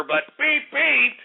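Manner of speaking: high-pitched imitation horn toots; horn beeps. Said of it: "tooting my own horn".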